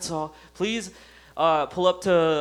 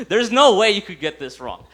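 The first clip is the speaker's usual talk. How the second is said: usual speaking voice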